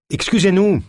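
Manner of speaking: high-pitched hail to a distant stranger; loud call. A narrator's reading. De Lune hails the man on the rock, calling out. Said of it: "lança-t-il d'une voix forte".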